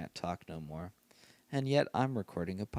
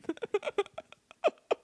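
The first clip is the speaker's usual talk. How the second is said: shrieking, helpless laugh, much higher; real high burst of laughter. Real, not acted. Host losing it over the predator's wiki page, high helpless laugh. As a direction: let the laugh break out high.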